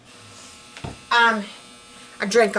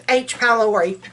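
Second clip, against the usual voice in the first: usual speaking voice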